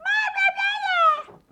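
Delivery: put-on high, slow, loud child's call. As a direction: shout it like a small child. Child calling out in game.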